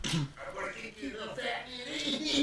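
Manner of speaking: put-on high-pitched bratty kid voice; very high, put-on child's jeer; taunting boy. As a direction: squeal a taunt like a little bully. Game master voices squabbling boys.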